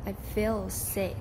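I feel sick, slow, falling down on sick; slow statement, tune falling on sick. Shown as a demonstration. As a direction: let your voice drop at the end on sick. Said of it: "cuối câu thì mình sẽ falling down".

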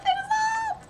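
very high, thin fan squeal; giddy falsetto cry; overjoyed squeal. Real, not acted. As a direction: squeal it in pure delight.

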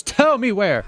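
furious shout. Acted. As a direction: scream the demand in his face. Barry interrogates Hector at stinger point.

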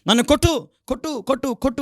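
high mock taunting wife; nagging wife. Preacher imitates a wife daring her husband to hit, high taunt. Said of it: "కొంతమంది భార్యలు ఇంట్లో భర్తల్ని సతాయిస్తూ".

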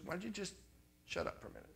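God's quiet aside, acted; understated, hushed telling-off; hushed rebuke. Acts God's reply to his fussing.

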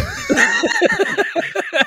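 high burst of laughter. Real, not acted. Laugh while joking.

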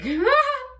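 high, helpless giggle; burst of laughter. Real, not acted. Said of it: "I can't stop laughing".

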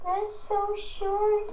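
high, drawn-out whine; whiny. Real, not acted. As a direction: stretch it out in protest. Protesting video length.

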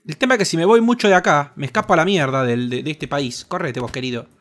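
ordinary talk

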